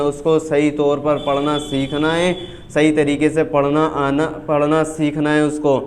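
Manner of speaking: usual speaking voice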